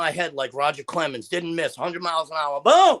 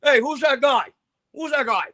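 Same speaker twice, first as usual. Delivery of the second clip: retold boxer puzzled; 6 semitones higher, 5 dB louder than usual. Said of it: "I'm left like".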